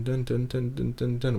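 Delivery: high, soft sung dun dun notes; synth imitation. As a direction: mimic a little synth line under your breath. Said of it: "this high little synth going through".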